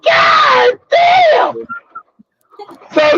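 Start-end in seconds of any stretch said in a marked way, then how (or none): 0.0-1.7 ecstatic yell. Retold or imitated. Comic reliving mind-blowing sex, high ecstatic yell. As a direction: cry it out in ecstasy.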